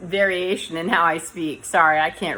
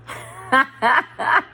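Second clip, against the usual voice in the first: bursting, higher-pitched laugh; real burst of amused laughter; 7 semitones higher, 3 dB louder than usual. Real, not acted. Laughing at a quoted Elon Musk joke, amused laugh.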